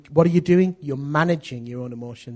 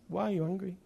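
softer, gentle imitated wife; soft question; 3 semitones higher, 8 dB quieter, faster than usual. Wife answering her husband's shouting, softly. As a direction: ask it quietly after the outburst.

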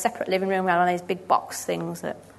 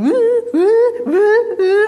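high, loud siren noise; much higher and louder mock alarm wail; imitated alarm; 10 dB louder, much slower than usual. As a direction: mimic a phone alarm going off.

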